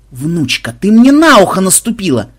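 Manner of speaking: loud pained howl on the second line; howling complaint. Acted. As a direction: yelp it out, hurt and outraged. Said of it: "взвыл Макмед". (обиженный вопль)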